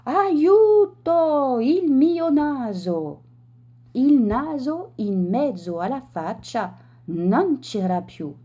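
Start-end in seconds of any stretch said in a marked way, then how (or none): 0.0-3.2 high, loud, then slowing on the nose; panicked cry for help. A narrator's reading. Man in dressing gown crying out at the mirror, loud panic. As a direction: shriek it in horror, losing your nose.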